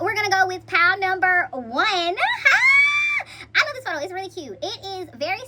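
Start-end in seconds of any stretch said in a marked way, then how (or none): 2.5-3.2 high excited squeal of delight. Real, not acted. Reader reacting to the first photo, excited squeal.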